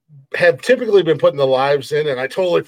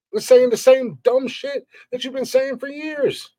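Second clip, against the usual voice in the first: real exasperated ranting, higher and higher; heated rant; 8 semitones higher than usual. Real, not acted. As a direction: voice climbing higher as the anger builds. Angry rant on his own show.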